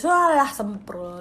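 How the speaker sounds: loud, slow mock outrage at the comment; Walah, semprol stretched out in playful outrage; mock exasperation. Real, not acted. Reacting to a viewer who says she never cries, loud, slow and mock-indignant.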